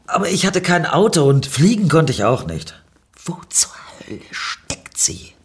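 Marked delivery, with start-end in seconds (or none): 3.3-5.3 annoyed murmur. A narrator's reading. Narrator has lost the stranger in traffic, annoyed murmur. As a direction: mutter it under your breath, irritated. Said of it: "murmelte ich genervt".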